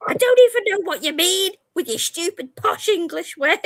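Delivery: put-on high, loud whiny teen, laughing through it; mocking teen voice. Daughter retorting to mum, high whiny mimicry with laughter. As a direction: imitate the daughter throwing it back. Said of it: "and she said".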